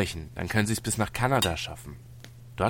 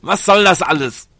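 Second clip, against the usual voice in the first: loud, high, desperate outburst; distraught protest; 6 semitones higher, 7 dB louder than usual. Acted. Captive asks tormentors why they torture them, distraught. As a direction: plead loudly against the torment.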